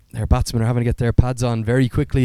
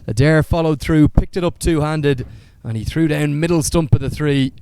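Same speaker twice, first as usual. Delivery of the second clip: raised, lively call of a run out; excited cricket commentary; 4 semitones higher, 4 dB louder than usual. Real, not acted. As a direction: call the wicket with rising excitement. Live play-by-play of run out.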